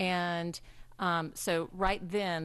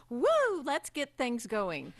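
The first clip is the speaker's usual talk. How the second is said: mock woo cheer; 5 semitones higher than usual. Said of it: "the person who comes in and says".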